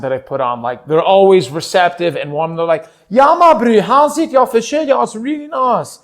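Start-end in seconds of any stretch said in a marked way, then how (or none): 3.1-6.0 high, loud South African greeting; hammed-up excited South African accent. Voicing receptive South Africans who love it, South African accent. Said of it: "South African be offended by the accent".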